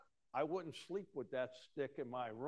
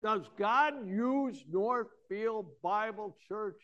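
ordinary delivery

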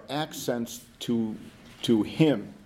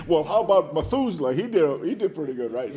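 imagined objector; 3 semitones higher, 4 dB louder than usual. Acted. Someone raising Methuselah against the preacher's point, lightly mimicked objector. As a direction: put on a mild challenger's voice. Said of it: "somebody would say that".

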